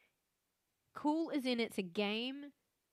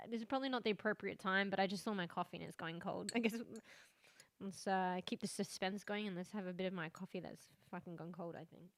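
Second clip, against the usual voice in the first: usual speaking voice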